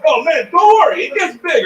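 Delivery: high, loud mock reassurance; flustered performer. Embarrassed performer reassuring the heckler, high and loud. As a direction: play the defensive guy, pitched right up. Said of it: "pulling it, going".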